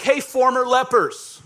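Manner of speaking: loud, high, drawn-out holler; yelled call. Acted. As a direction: call out to people far off, stretched out. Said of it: "we yell at 'em like".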